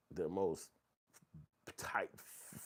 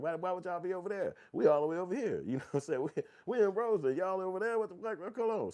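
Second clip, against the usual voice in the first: incredulous, much higher voice; mock outrage, pitched way up; high mock disbelief; 12 semitones higher, 6 dB louder than usual. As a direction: act out baffled neighborhood pride. Mocking folks from other hoods.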